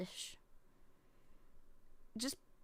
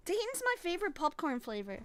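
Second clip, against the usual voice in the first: ordinary delivery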